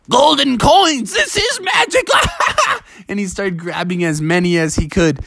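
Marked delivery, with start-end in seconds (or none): 0.1-2.1 high-pitched gleeful squeal; acted ecstatic outburst rising into falsetto; thrilled Bubby. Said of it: "And Bubby was like". 2.1-2.8 very high giddy character laugh; acted squealing laughter; gleeful cackle. Bubby delighted by falling coins.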